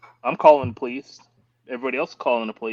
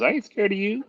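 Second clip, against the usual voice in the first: mocking bully; 12 semitones higher than usual. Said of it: "And he goes".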